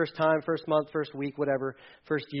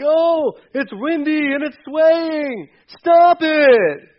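put-on whiny baby eagle; 11 semitones higher, 11 dB louder, much slower than usual.

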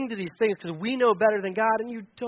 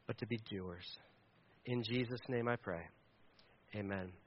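closing prayer; 4 semitones lower than usual. Acted.

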